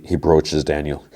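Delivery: ordinary delivery, nothing marked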